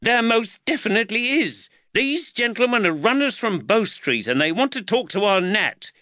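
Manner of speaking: a wife indignant at her husband; irate mother Giacchetta; angry mother. Acted. Giacchetta speaking.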